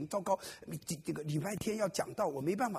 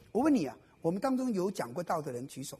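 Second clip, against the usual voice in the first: normal talking voice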